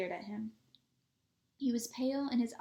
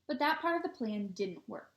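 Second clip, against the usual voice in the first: ordinary delivery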